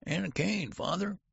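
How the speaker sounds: very high, demanding elder whine; old man calling. A narrator's reading. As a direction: call after father in a high, querulous voice. Son's voice calls after father.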